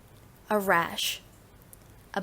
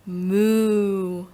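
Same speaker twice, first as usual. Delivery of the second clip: long, swelling moo; cow moo; 4 dB louder than usual. Shown as a demonstration. Teacher demonstrating animal sounds, drawn-out cow moo. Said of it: "Animal sounds".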